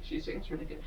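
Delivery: breathy unvoiced whisper; hushed whisper under the breath; whispered aside. Real, not acted.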